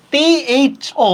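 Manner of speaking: টি এইচ ও stretched slow and pitched high; stressed letters. Shown as a demonstration. Teacher showing which letters make the sound, stressing টি এইচ ও. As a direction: draw out টি এইচ ও, each letter held high. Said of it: "এটার কারণে ঠ আসছে".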